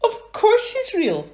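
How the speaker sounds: high, loud indignant splutter; high spluttering character voice; spluttering Hamish. Acted. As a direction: stammer out the protest. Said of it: "spluttered Hamish".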